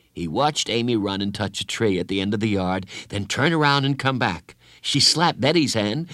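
plain reading voice

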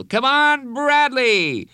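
high, loud cheer; cheering yell. Acted. Teammate yelling Bradley on, loud cheer. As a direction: urge him on at full voice. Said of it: "he heard someone yell".

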